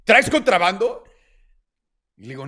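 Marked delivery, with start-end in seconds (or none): 0.1-1.0 loud, higher triumphant accusation; mock interrogator springing the trap; gotcha cop. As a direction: pounce on him like you caught him.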